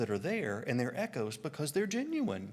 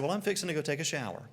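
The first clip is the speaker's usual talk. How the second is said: rapid offhand remark, pitched up; casual quick announcement; 4 semitones higher, much faster than usual. Retold or imitated. Traveler casually telling the mission group, quick and offhand. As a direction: toss it off quickly and lightly.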